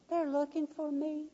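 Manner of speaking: high, soft whisper with a giggle; giggling child's whisper. A narrator's reading. Hiding child whispering to the boss, giggling. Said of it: "with a little whisper and a little giggle".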